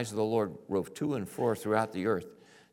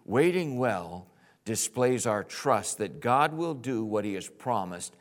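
ordinary delivery

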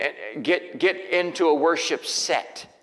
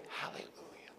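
a soft aside of praise; whispered hallelujah; 22 dB quieter than usual. Real, not acted. Preacher to himself, a whispered hallelujah. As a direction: breathe it under your breath.

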